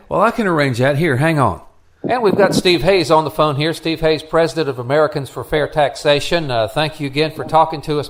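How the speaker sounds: usual speaking voice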